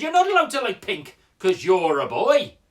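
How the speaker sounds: put-on loud, insistent Geordie boy; imitated Newcastle boy, loud and adamant; Newcastle accent. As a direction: lay down the law in high Geordie. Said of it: "He said".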